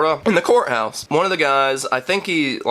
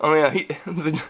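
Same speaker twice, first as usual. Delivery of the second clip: ordinary talk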